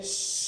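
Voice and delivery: स as a long soft unvoiced hiss; breathy sibilant demo. Shown as a demonstration. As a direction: let the air hiss out on स. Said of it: "इनके उच्चारण से पहले ही श".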